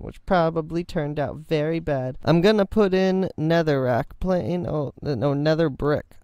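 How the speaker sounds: usual speaking voice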